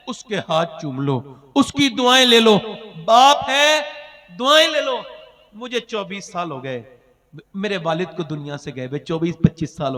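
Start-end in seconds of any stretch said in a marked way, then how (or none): 0.1-5.0 fervent plea. Real, not acted. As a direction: urge the crowd to seek their parents' blessings. Preacher pleads with congregation.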